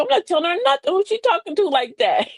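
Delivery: high-pitched indignant refusal; acted affronted pushback; apprehensive, defensive. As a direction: bristle at being questioned. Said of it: "you got kinda apprehensive".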